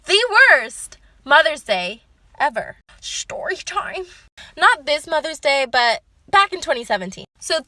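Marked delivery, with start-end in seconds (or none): 3.0-4.0 slow, stretched half-whisper; soft, drawn-out storyteller's tease; hushed title tag. Pretend. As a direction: softly draw out the catchphrase.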